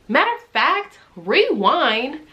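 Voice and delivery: abrupt high jump to rewind; sudden high self-correction. Real, not acted. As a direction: cut yourself off suddenly.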